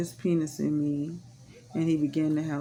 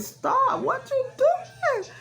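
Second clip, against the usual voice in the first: a much higher screamed plea, slow on doing; much higher desperate screaming plea; retold scream; much slower than usual. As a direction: scream it as she relives it. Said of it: "I was screaming".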